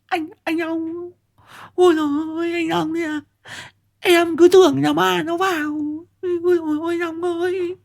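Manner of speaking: put-on a frightened young wife sobbing with relief; lisping relieved cry. A narrator's reading. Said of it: "Liên ngọng buột miệng kêu lên".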